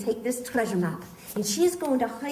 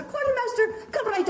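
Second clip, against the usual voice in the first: high sing-song call; 11 semitones higher than usual. Real, not acted. Host calling the quartermaster up high. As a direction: call her up with a bright lifted voice.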